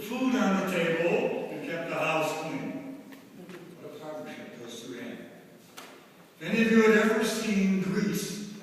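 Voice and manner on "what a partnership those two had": soft, hushed tribute; dignified grief. Real, not acted. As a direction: say it softly in fond memory. Eulogy, tender tribute.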